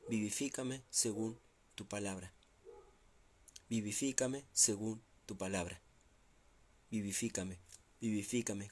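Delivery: hushed, pleading prayer voice that fades away; soft prayer. Acted. Pastor voicing the psalmist's plea, soft fading prayer. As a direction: pray it gently as the psalmist.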